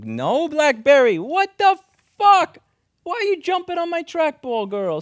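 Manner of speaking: sudden loud yell at a pet; yelling at the cat. Real, not acted. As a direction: bark at the cat to get off. Cat jumps on trackball.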